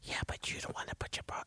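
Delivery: barely voiced, hurried whisper; whispered reproach. Co-host voicing the truth hitting the dad, whispered. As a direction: whisper it like a nagging conscience.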